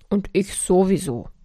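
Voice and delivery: grumpy character voice with some force; grumbling. A narrator's reading. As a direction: grumble it as loud Anger. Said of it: "brummte die laute Wut".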